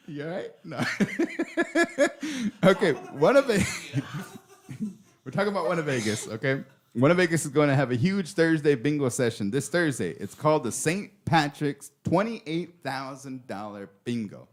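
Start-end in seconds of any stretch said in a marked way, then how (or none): 0.0-10.1 high laughing, fighting to talk. Real, not acted. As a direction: laugh through the words, then recover. Host laughing through question.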